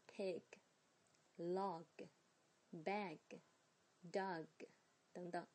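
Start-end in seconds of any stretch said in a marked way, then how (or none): 0.1-4.5 "pig, log, bag, dog" slowly with soft, unexploded g; light final g. Shown as a demonstration. 老师慢慢示范词尾轻读的g. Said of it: "我们一定要轻一点".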